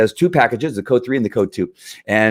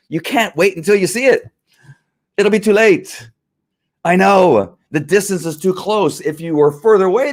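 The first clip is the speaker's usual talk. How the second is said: raised, heated and emphatic; fired up; 8 semitones higher than usual. Real, not acted. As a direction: push it hard, wound up. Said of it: "I'm just fired up right now".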